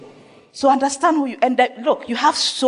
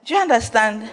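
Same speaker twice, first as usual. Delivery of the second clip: ordinary talk, nothing marked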